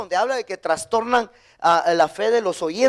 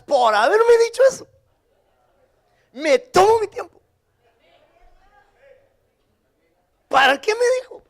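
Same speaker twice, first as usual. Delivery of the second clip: high, louder, playfully offended; mock indignant; 10 semitones higher, 4 dB louder than usual. Real, not acted. Preacher teasing the congregation, mock indignant. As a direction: pretend to be put out by the hurry. Preacher mocking a remark, pitch jumps.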